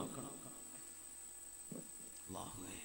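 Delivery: breathless, drawn-out whisper of a dying man; whispered last plea to God, barely there. Acted. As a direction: let the name fade out on a last breath. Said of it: "മരിക്കാൻ പോകുന്ന രംഗം നോക്കണം".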